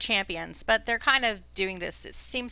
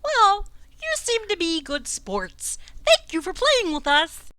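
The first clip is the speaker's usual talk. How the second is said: high, proud croaking king voice; warbly frog king; 6 semitones higher than usual. Acted. Frog king chats with the passing warriors, high and warbly. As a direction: play the pompous frog king. GM holds the king's voice.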